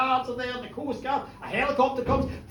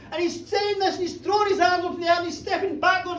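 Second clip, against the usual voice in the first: ordinary talk, nothing marked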